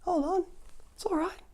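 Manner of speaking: very high, pleading call; bears begging her to stay; bear calling her back. Retold or imitated. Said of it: "the bears are like".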